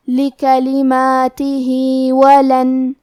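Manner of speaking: slow melodic tajweed chant; Quran recitation. Shown as a demonstration. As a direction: recite slowly with measured tajweed.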